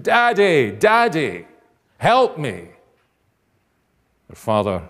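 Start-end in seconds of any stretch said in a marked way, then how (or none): 0.0-2.7 high, loud cry for Daddy; child's shout for help. Acted. Boy with bleeding nose shouts for father, urgent high shout. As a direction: shout out like a hurt boy calling his father. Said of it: "you shouted".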